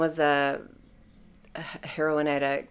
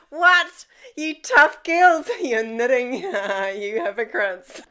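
high, loud, laughing incredulous teasing; mock the tough girls for knitting, half laughing; amused shock at the knitters; laughing disbelief; 8 semitones higher, 6 dB louder than usual. Mocking knitting cellmates.

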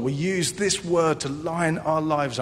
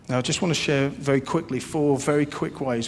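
ordinary talk, nothing marked

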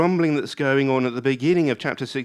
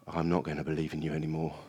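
low, quick, soft threat; testing God; 6 semitones lower, 7 dB quieter, faster than usual. Acted. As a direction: drop to a hurried, low threat. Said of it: "what starts as grumbling can morph into testing".

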